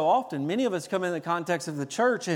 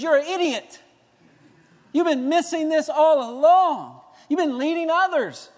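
higher and louder scolding; stern rebuke; 7 semitones higher, 5 dB louder than usual. Retold or imitated. Jesus scolding the self-satisfied believer, stern. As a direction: scold them for missing it.

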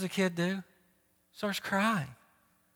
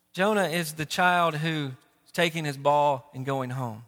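normal talking voice

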